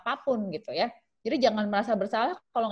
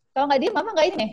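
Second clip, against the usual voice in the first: higher, louder scolding threat; angry threat; 7 semitones higher, 5 dB louder, much faster than usual. Shown as a demonstration. Mother threatening her screaming child, loud angry scolding.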